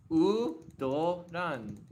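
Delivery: "udoran" stretched slowly, syllable by syllable; drawn-out demonstration. Shown as a demonstration. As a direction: slowly sound out "udoran" as oo, do, ran. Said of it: "best way to say it".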